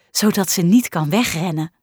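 ordinary delivery, nothing marked

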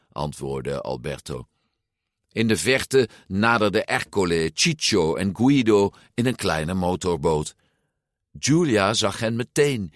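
usual speaking voice

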